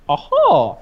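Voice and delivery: high delighted exclamation; mock aha. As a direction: catch the joke out loud. Retold reaction to jokes.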